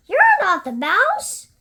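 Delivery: high, loud, disappointed; child voice. Acted. Gruffalo's child telling the snake, high and loud. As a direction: call it out like a letdown kid.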